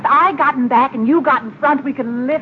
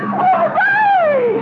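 cheering. Acted. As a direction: shout hooray in triumph. Cheering as engine runs.

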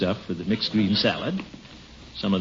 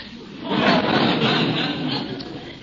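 sly laugh; 13 semitones higher than usual. Acted. Gildy chuckles to himself.

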